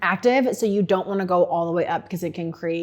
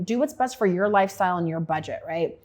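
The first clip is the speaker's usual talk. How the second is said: ordinary talk, nothing marked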